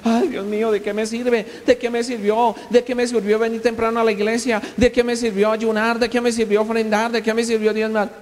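high, whiny lament of a self-pitying believer; mock whining complainer. Preacher mocking a complaining believer, high whiny voice. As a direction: whine like a churchgoer feeling cheated by God. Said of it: "Hay gente que cualquier prueba inmediatamente comienza".

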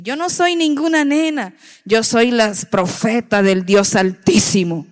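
put-on a child's commanding declaration to her grandmother; with authority. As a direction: proclaim it like a little girl with great authority. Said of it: "así con esa autoridad".

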